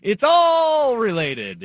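loud, slow, drawn-out proclamation; mock dramatic shout; hammed-up shout. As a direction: bellow it out slowly, like a quote.

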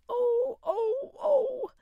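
high, loud pitiful groans of pain; hammed-up peasant moaning in pain; pitiful groaning. A narrator's reading. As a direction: wail at the ache in his ear. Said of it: "began groaning in a pitiful fashion".

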